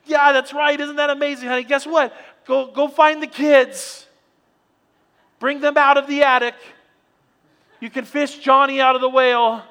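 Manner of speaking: high, loud excited shouting, slowing on the orders; shouting with joy. Acted. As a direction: yell the good news down the phone. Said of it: "he goes".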